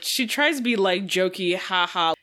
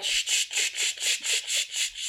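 whispered sh page-flip noise; newspaper page turning sound; 4 dB quieter than usual. Shown as a demonstration. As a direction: mimic rustling pages with sh. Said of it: "Doing the, like".

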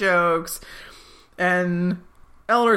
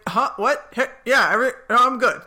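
flustered, higher and louder with a laugh; startled; 6 semitones higher, 4 dB louder than usual. Retold or imitated. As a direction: caught off guard, covering it. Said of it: "Chidi's like".

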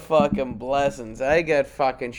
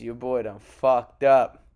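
normal talking voice

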